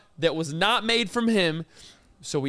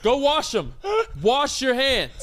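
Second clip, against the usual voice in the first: firm raised call to wash up; hollering at the vendor to go wash; calling out to worker; 4 semitones higher, slower than usual. Retold or imitated. Friend retells telling the corn dog guy off, raised call. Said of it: "I was like".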